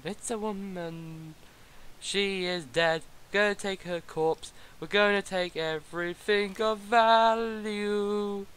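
slow drawn-out grumbling; slow drawling voice. Acted. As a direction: drag every word out lazily. Drawn-out rant voice.

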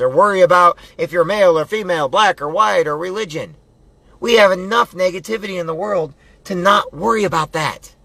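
high, forceful pep talk with punched commands; fired-up motivational preaching. Real, not acted.

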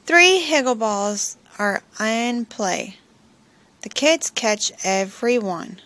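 usual speaking voice

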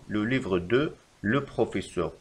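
slow, even reread; slow repeat. Shown as a demonstration. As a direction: repeat it slowly. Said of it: "فالخطأ في هذه الجملة".

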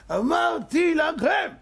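high, slow full shout. Acted. Teller shouting the repeat at his listeners, slow and high. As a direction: stretch and bellow אמרתי, stressing אמרתי.

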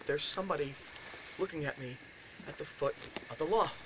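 softly voiced, ominous report; hushed dread. Roommate describing who watches from the loft's foot, hushed. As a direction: let it out quietly, bit by bit. Friend's eerie report retold.

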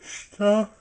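word pulled out by a yawn. Real, not acted. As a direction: yawn right through the word. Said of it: "Sorry about the yawning".

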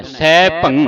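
ਸਹਿ ਭੰ loud, held on the nasal with no g; stop on nasal. Shown as a demonstration. Teacher showing where to stop, loud nasal ending. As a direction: halt ਭੰ before any g sound. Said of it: "ਇੱਥੇ ਰੋਕ ਦੇਣਾ ਹੈ".

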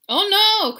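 high, loud alarmed cry; cried out. Acted. Kitten crying out as tower falls, loud. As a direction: yelp in alarm. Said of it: "cried Scapito".

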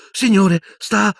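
high, loud, broken-off question; urgent hopeful call. Acted. Boy seeing his professor stir, urgent call. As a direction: call to him anxiously. Startled hope as eyes flicker.

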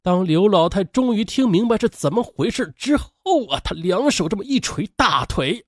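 ordinary delivery, nothing marked

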